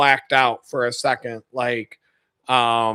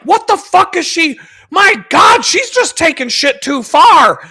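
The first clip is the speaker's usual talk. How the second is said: hammed-up indignant shouting; mock outraged rant; 12 semitones higher, 6 dB louder than usual. Buddy ranting about the woman in the clip, mock outrage. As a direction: imitate a friend ranting, high and loud. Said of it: "he's going on and on and on".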